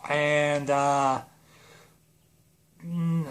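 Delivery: ordinary talk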